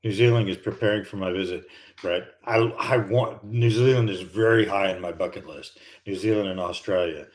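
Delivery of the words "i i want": ordinary talk, nothing marked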